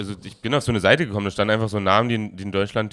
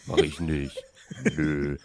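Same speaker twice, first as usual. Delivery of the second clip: low, throaty refusal; lazy layabout's low croak; Arno Dübel; 6 semitones lower, 6 dB quieter, much slower than usual. Acted. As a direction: drop the voice and refuse in short bits. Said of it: "Ich kling wie Arno Dübel".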